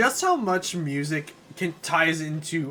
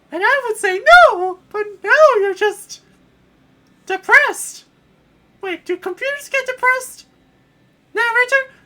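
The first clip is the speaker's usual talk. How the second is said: much higher, worried princess; high falsetto princess fussing; 10 dB louder than usual. Acted. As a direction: fret in a shrill falsetto. Peach voice.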